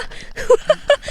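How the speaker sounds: high giggle; high laugh. Real, not acted. Laughing at own story.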